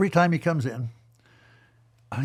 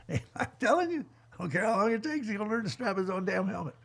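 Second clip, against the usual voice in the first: higher, half-laughing insistence; mock insistence; 6 semitones higher, 4 dB quieter than usual. Coach telling laughing riders he means it, higher and amused. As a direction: playfully dig in your heels.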